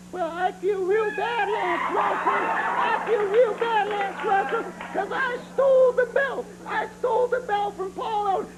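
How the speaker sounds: high squeaky whimpering doll voice; mocking squeaky puppet voice; Mister Bill doll voice. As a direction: voice a tiny pitiful doll, way up high. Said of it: "this Mister Bill doll can talk".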